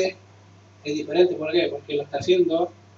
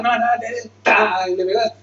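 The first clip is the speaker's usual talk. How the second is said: ordinary talk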